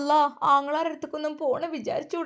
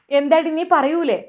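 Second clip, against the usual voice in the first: loud, harsh mother-in-law scolding; menacing mother-in-law demanding an answer; angry demand; 4 semitones higher, 8 dB louder than usual. Acted. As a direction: bark the question at her. Mother-in-law threatens the wife.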